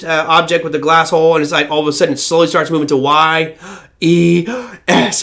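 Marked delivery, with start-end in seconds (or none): 3.8-5.2 mock gasps. Ouija spelling out letters.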